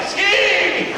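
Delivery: high yelled warning; mock yell. Man on the slope yells a warning, high mock yell. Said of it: "The man was yelling".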